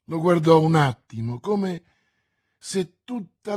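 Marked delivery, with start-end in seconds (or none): none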